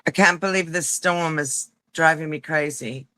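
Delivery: ordinary talk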